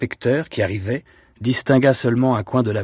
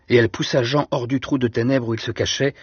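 ordinary delivery

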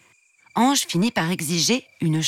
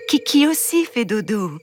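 lightly raised sing-song bedtime voice; coaxing mother queen; 5 semitones higher than usual. Acted. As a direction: lull the child toward sleep. Queen soothes.

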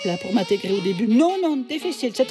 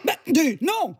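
indignant protest; 4 semitones higher, slower than usual. Acted. Indignant at the insult.

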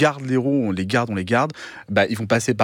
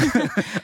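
laugh; 10 semitones higher than usual. Real, not acted. Host jokes about burping in class.

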